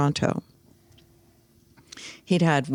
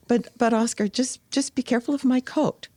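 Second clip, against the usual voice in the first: higher, pleading voice for Ella; imitating Ella; 8 semitones higher than usual. Ella asking Oscar to mind her fur coat, high and pleading. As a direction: play Ella nervously guarding her new coat. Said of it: "And Ella said".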